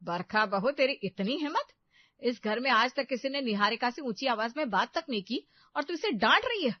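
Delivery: loud, climbing indignation; outraged scolding. Acted. Mother-in-law berates Barkha for scolding her daughter, outraged scolding. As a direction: build to a high, outraged question.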